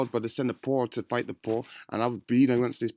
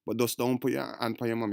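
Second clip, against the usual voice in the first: normal talking voice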